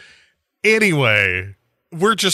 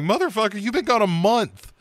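mock outrage; 10 semitones higher, faster than usual. Friends yelling at Kofi.

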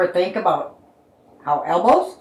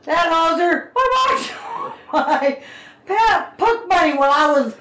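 high barking Canadian voice; Canadian accent; 12 semitones higher, slower than usual. Acted. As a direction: bark it out, hammy Canadian. Card read as Canadian.

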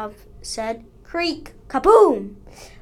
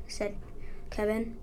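ordinary delivery

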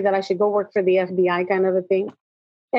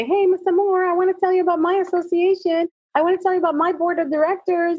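higher-pitched imitation of a conference-goer; mimicked eager attendee; 7 semitones higher than usual. Property management attendee approaching the speaker after her talk, mimicked high.

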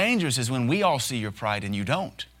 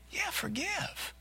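much higher, much softer, slow sing-song coo; baby-talk tone; 9 dB quieter, much slower than usual. Shown as a demonstration. Pastor showing how he softens his voice for baby Christians, high gentle coo. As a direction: coo it slowly like talking to a toddler. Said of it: "change the tone of voice when you're dealing with babies".

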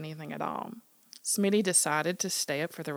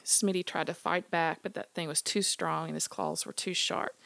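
ordinary delivery, nothing marked

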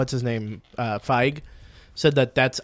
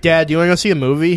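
rushed; 3 semitones higher, 4 dB louder, faster than usual. Retold or imitated. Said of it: "I was like".

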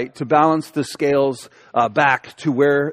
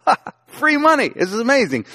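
mock-gleeful, much higher cry of delight; 7 semitones higher than usual. Young man at bank machine, pitched high with mock glee. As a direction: play your younger self thrilled by free money. Retold delight at free cash.

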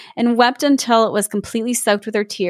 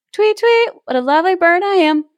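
much higher, twittering bird voice; chirpy bird; 8 semitones higher than usual. Acted. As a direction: chirp it like a proud little bird. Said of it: "the bird sang again".

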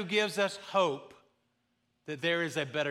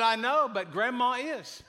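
put-on child reply; 6 semitones higher, 3 dB louder than usual. Boy's punchline reply.

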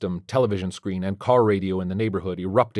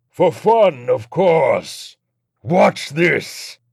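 high, loud demonic taunt; taunting demon, pitched up; gleeful demon Legion; 7 semitones higher, 6 dB louder, much slower than usual. Acted. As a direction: toy cruelly with the priest.

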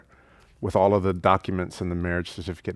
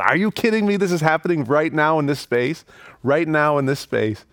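stunned excitement; 6 semitones higher than usual. Retold or imitated. Guests learning the wedding is happening right now, stunned and thrilled. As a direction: shriek it like you can't believe it. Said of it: "another eruption of enthusiasm".

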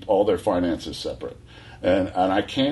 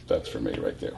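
lower, softer confiding aside; wife-to-be spotted, a hushed aside to a friend; smitten aside; 10 semitones lower, 7 dB quieter than usual. Retold or imitated. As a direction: murmur it to a friend, lovestruck. Telling friend he's smitten.

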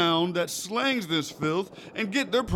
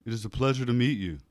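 much lower, stiff polite voice; deep formal voice; 12 semitones lower, faster than usual. Acted. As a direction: put on a deep proper voice. Nervous boyfriend very deep formal.

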